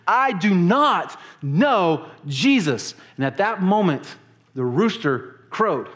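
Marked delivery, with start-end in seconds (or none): 0.1-3.0 slow, high, emphatic acted denial; near-cursing denial. Peter almost cursing at his questioner, forceful slow denial. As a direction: hit each word hard and slowly, as if cursing. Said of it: "he's actually cursing at this person".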